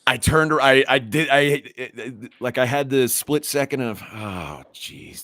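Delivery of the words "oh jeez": hushed, low sinking aside; soft, dropping retold dread; dreading inner thought. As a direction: mutter it under your breath as a worried thought. Inner thought before the confrontation.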